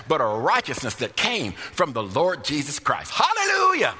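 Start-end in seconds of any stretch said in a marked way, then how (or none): 3.1-3.9 high ringing hallelujah; soaring preacher's hallelujah; hallelujah shout. Real, not acted. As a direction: cry it out high and loud. Preacher exclaims at high pitch.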